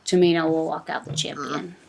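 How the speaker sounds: ordinary delivery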